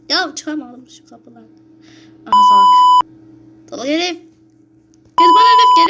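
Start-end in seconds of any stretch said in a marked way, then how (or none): 2.2-5.9 high, very loud taunting yell; shout. Real, not acted. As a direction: scream defiance at the killer. Yelling at the killer in-game. (yüksek sesle meydan okuyan bağırış)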